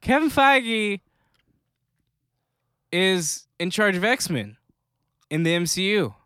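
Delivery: high, breathless fan excitement; gushing over big news; excited gasp. Retold or imitated. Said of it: "Everyone was like".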